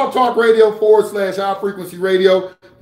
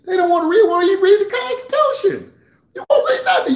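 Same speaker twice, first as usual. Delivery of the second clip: exasperated outburst; 13 semitones higher than usual. Real, not acted. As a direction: pitch climbing much higher, breaking into a laugh. Ranting at viewers who won't read.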